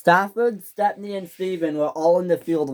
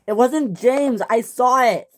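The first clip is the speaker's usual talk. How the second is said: urgent defence; 9 semitones higher, 6 dB louder than usual. Acted. Engine defends James loudly.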